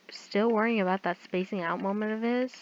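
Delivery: ordinary talk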